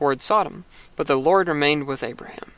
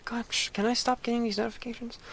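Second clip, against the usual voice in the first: annoyed aside; 7 semitones higher, 10 dB quieter than usual. Real, not acted.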